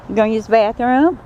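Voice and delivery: high coaxing pet voice; sing-song question to the snake; pet talk. Real, not acted.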